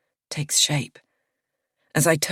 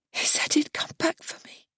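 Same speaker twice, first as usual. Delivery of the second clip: high, breathy whisper; 12 semitones higher, 5 dB quieter than usual. A narrator's reading. Miriana to narrator about Gareth, whispering. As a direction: whisper it as a lost secret. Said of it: "she whispers".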